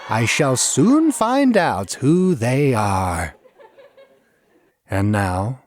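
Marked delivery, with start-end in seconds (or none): 0.1-3.3 high, slow, loud shrill cry; screaming jester. A narrator's reading. Jester on the chain screaming down at the apes. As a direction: shriek it from the chain. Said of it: "and still screaming".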